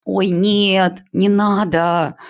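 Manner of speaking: high, slow cry of alarm; scream. A narrator's reading. A pupil in the online lesson, high slow cry. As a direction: wail it out high and drawn. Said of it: "Кто это сейчас закричал?".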